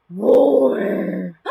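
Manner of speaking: loud, drawn-out playful roar; big mock lion roar. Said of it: "The lion goes".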